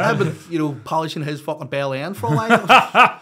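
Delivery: words spilling out through big laughter; laughing. Real, not acted. Laughing at his own crude line.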